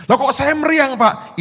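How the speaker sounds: high, loud, puzzled complaint; mock protest. Immunised man protesting his fever to pastor, mock alarmed. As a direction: imitate an alarmed patient questioning the doctor.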